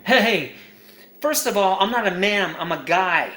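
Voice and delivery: ordinary talk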